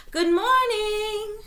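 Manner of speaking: high, slow singsong greeting; bright girlish call, high and loud; cheery high call. A narrator's reading. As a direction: sing it out brightly like a little girl. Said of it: "she called to the rabbits".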